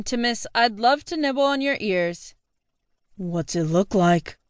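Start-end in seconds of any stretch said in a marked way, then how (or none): 3.2-4.4 put-on lower, slow, slurring drunk charm; smooth-talking tipsy boyfriend; drunk guy voice. Drunk Alex answers back.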